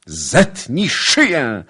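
loud, high, drawn-out cry. A narrator's reading. Suitor offering his neck to his lady, crying out. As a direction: throw it out as a dramatic plea. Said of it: "zawołał Kmicic".

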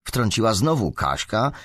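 usual speaking voice

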